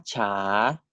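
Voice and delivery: cha dipping low then rising; Thai rising tone. Shown as a demonstration. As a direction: let cha sink then climb. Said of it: "they occupy the other end of the spectrum".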